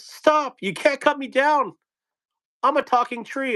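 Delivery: high yelling tree voice. Acted. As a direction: yell up high like a talking tree. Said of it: "a tree yells".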